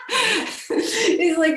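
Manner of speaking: high, loud laugh running into the words. Real, not acted. Retelling the taxi driver's lecture, laughing loudly. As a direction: laugh through the lead-in.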